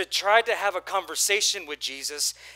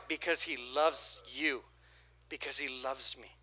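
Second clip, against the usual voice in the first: much softer, gentle answer; tender; 5 semitones lower, 8 dB quieter than usual. Real, not acted. Pastor telling the church God loves them, tender. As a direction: say it softly and sincerely.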